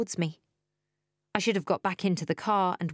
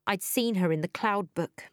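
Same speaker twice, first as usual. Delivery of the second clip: ordinary delivery, nothing marked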